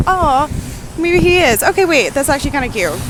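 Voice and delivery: real, loud sudden delight; touched excitement. Real, not acted. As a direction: gush as it dawns on you. Touched by grandpa sign.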